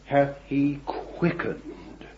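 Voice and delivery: slow, weighty reading of the verse; scripture reading. Real, not acted. Preacher reading Ephesians aloud to the congregation, slow and solemn. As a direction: lean on each word like holy writ. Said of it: "this is what it says".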